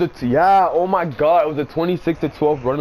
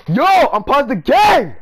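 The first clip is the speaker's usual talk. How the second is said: much higher, louder, slow yell to unpause; angry shout; 9 semitones higher, 7 dB louder, slower than usual. Real, not acted. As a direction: bellow it out in frustration. Opponent keeps game paused.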